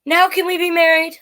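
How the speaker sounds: little worm asking again, high; pleading worm. Acted. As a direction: ask it wistfully, stretched out.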